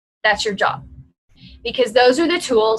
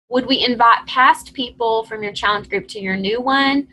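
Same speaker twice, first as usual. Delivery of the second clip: ordinary delivery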